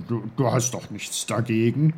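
put-on character voice, excusing himself; apologetic. A narrator's reading. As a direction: ask leave with a stammered start. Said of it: "Er entschuldigte sich bei ihr".

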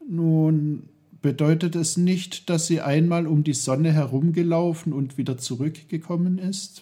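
put-on high, fast, eager boyish answer. A narrator's reading. Peter eagerly answering Onkel Pfefferkorn's question, quick and high.

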